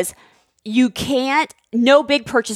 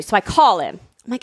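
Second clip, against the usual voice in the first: ordinary talk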